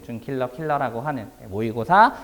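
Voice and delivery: ordinary delivery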